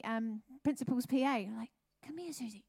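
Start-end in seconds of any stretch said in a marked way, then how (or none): 2.0-2.6 hushed, urgent whisper. Retold or imitated. School receptionist whispering urgently to Susie to come. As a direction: pull her aside in a secretive whisper.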